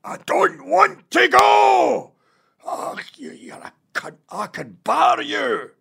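sleep-talking Scottish roar, slow and very high; dad's real Scottish accent. Acted. Dad ranting in his sleep about his job, roaring in his real Scottish accent. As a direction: bellow in raw Scottish, dropping to unvoiced cursing between outbursts. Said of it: "His real accent you hear in his sleep".

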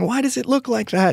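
very high, horrified mock yelp; mock horrified scream. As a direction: son watching the birth, high mock horror. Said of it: "he's screaming and crying".

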